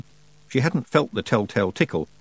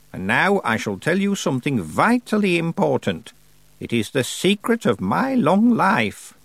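put-on raised, deliberate voice of an imperious old witch; old witch Miss Treason; 5 semitones higher than usual. Old witch advising young Tiffany, imperious and slow. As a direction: play the grand old witch holding court.

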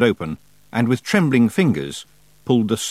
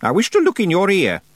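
put-on stern old witch; 10 semitones higher, 5 dB louder than usual. A narrator's reading. Miss Treason voiced, high and loud.

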